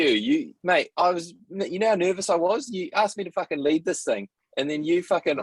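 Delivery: high, fast tirade, wound up; worked-up rant. Real, not acted. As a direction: rant at him, half joking, half fuming. Venting at co-host over flubbed intro.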